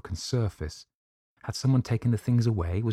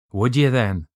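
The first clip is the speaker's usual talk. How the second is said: loud, higher-pitched Irish challenge; Irish accent; 5 semitones higher, 8 dB louder than usual. Acted. Irish doctor seizing the narrator's arms, loud Irish accent. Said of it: "in his own unmistakable accents".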